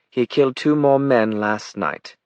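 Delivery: high, slow aristocratic British; deliberate British correction; interrupting British accent. A narrator's reading. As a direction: cut in with upper-class British precision. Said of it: "said Jenna, interrupting".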